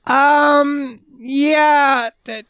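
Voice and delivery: very high, slow, drawn-out dopey voice; a customer phoning the store, voiced very high and slow; clueless caller impression. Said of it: "And they're like".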